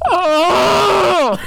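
high yelping cry, hammed-up; mock anguished cry. Co-host mimics the mic-muffled frustrated scream, mock cry. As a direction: mimic a pained yell through a bad mic. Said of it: "just going like".

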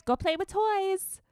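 high, slow mock baby talk. Imitating her telling the child to play, baby talk. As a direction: coo it up high as if to a toddler. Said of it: "like, to a baby".

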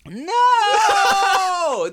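shouted high no, half laughing; loud exasperated no. Real, not acted. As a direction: shout it down, amused frustration. Friend keeps guessing wrong show.

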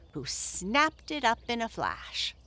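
usual speaking voice

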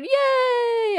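high, loud whoop of joy; excited cheer. Real, not acted. Celebrating hundredth episode.

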